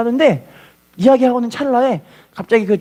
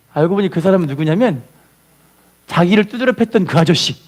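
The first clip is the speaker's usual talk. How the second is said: ordinary delivery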